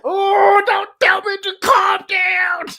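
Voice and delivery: wailing, high-pitched shout; comic re-enacted frustrated scream; hyped-up outburst. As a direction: explode at being told to settle, stretching words. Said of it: "I can get hyped".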